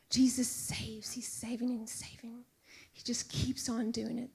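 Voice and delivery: soft, breathy whisper of awe; whispered wonder. Real, not acted. Preacher marvelling that Jesus keeps saving, whispered.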